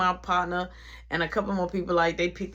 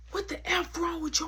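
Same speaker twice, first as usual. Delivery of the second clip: heated confrontation; 9 semitones higher, 5 dB quieter than usual. Retold or imitated. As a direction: act out demanding an answer. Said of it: "I'm yelling, I'm going off".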